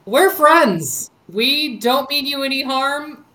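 calling out in character. Acted. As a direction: announce peaceful intent, projecting. Player calls out to hidden creature.

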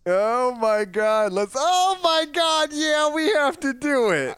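high, drawn-out gleeful squeal; slow, delighted high exclamation; excited. Real, not acted. Thrilled at gorilla comic pick.

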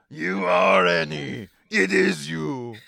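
high, loud, gravelly alien trader; high, loud alien junk dealer; Watto voice. Acted. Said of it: "that was going full Watto there".

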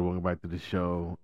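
normal talking voice

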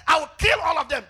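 loud, high acted declaration; booming preacher's retelling; wrathful God. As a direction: thunder it as an angry God. Acts out God's wrath to Moses.

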